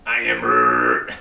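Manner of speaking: very deep, loud, slow creature voice; slow, booming tree-monster voice; Groot. Acted. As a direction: drag it out in a huge low growl. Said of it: "he probably would just".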